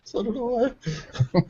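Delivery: so did I through a soft laugh; chuckling it out, amused. Real, not acted. Laughing at burnout confession.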